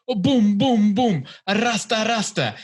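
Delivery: loud, high hammed-up Jamaican voice; retelling a comic's loud mock Jamaican bit; mock Jamaican accent. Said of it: "she does, like, a stereotypical ... Jamaican accent".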